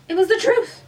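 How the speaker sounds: truth stressed with a high, loud rise; emphatic. A narrator's reading. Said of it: "said emphatically".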